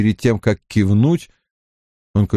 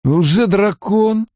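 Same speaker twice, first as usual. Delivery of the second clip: high, drawn-out horrified question; aghast disbelief; 9 semitones higher, much slower than usual. A narrator's reading. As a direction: echo it in slow dismay. Mat aghast at Rand's revelation. (потрясённо, протяжно)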